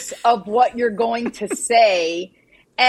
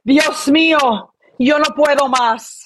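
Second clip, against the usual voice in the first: Spanish accent; 2 semitones higher than usual. Shown as a demonstration. Teaching co-host a Spanish phrase, Spanish accent. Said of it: "a phrase in Spanish".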